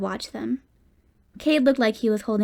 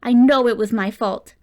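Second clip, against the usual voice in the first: anguished outburst; 8 dB louder than usual. A narrator's reading. Son's guilty outburst.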